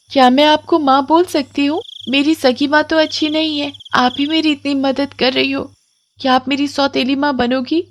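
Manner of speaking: put-on shy hopeful girl. Komal softly asks the witch to be her mother. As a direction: ask shyly, hoping for a yes.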